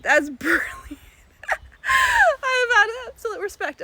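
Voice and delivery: loud, high laughter through words; helpless laugh-shout; laughing hard. Real, not acted. Laughing at the king monkey stealing the bag.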